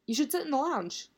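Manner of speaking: blurted. Acted. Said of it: "Victoire blurted".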